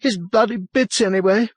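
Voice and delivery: high, frantic exasperation; agitated retort. A narrator's reading. Wild-eyed woman snaps back.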